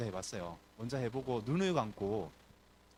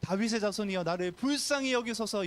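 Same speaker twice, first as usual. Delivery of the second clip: loud cry; 7 semitones higher, 3 dB louder than usual. Acted. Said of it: "큰 소리로".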